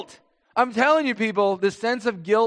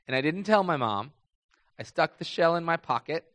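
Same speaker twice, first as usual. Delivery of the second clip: normal talking voice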